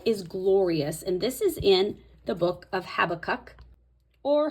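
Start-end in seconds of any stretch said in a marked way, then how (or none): 2.9-3.5 Habakkuk as HAB-uh-kuk, stress up front; one pronunciation. Shown as a demonstration. Vlogger naming the Bible book her way, first-syllable stress. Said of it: "I like to say Habakkuk".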